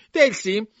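high, loud call of a name; girl calling the servant, loud and high. Acted. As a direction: sing out the maid's name from the table. Anna calls to Dilsey again.

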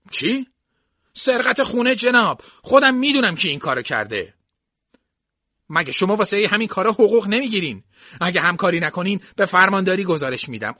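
high, loud indignant tirade; angry threatening. A narrator's reading. Jason threatens to report the sheriff by phone, angry.

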